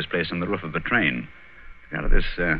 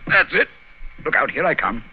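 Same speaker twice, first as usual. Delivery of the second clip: higher, effortful urging; effort of climbing; 12 semitones higher, 4 dB louder than usual. Acted. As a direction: call it out while heaving someone up. Straining to climb up.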